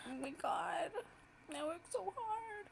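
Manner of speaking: high, tearful, voice breaking; real happy tears; crying. Real, not acted. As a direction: weep out the relief. Overwhelmed after launch.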